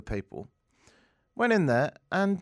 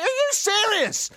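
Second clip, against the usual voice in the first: high, slow disbelief; can't believe what he's hearing; incredulous at the gushing crowd; 3 dB louder, slower than usual. Retold or imitated. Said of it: "And I was like".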